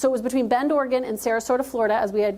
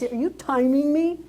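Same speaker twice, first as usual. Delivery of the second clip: slower, pointed, sassy challenge; mock indignation; 3 semitones higher, 3 dB louder than usual. Speaker teasing the timekeeper with slow mock outrage. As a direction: draw each word out like a playful accusation. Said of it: "I almost was like".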